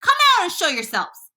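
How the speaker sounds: high-pitched commanding call; shouted order. A narrator's reading. Police chief orders the travelers out, shouting. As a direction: call the order up to someone above. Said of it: "shouted the chief of police".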